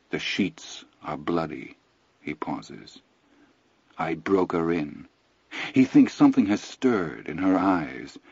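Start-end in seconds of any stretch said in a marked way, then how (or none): 0.1-5.1 low, soft and cold; quiet cruel reveal. Acted. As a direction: drop it quietly like a blow.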